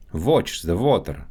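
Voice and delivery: Russian accent, "Watch" said as "votch". Shown as a demonstration. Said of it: "W and V sounds".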